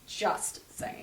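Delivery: a sly whispered echo; whispered aside. Shown as a demonstration. The host mutters it again to her cohost, in a whisper.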